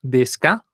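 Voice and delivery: "Desu ka?" con subida en "ka"; entonación de pregunta. Shown as a demonstration. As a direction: sube la voz en "ka". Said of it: "Esa es la entonación de pregunta". (modelo de pregunta con "ka" ascendente)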